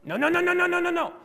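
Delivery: rapid-fire "no, no, no" on one very high pitch; mock parent's high-pitched burst of "no, no, no"; emphatic repetition. Parent talking to kids, "no" repeated with high, insistent emphasis. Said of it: "put emphasis on something, you're gonna repeat it".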